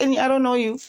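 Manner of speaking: a lightly voiced snooty cast member; mock quote. Mocking Doctor C's line.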